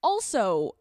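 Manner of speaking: ordinary delivery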